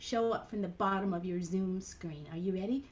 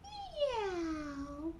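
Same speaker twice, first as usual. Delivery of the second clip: high, soft meow; cat meow; 8 semitones higher, 5 dB quieter than usual. Shown as a demonstration. Meowing along with the children. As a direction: mew like a kitten. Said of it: "Let me hear your meow".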